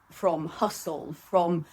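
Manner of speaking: ordinary delivery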